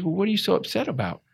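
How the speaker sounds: high, quick puzzled question; Doug. Retold or imitated. Retold mentor questions his upset.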